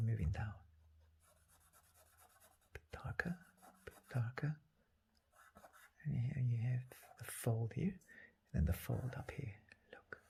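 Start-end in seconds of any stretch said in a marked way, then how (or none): 2.7-4.5 whisper. Real, not acted. Painter murmurs while working brush. 6.0-10.2 quiet murmur. Real, not acted. Painter murmurs while working brush.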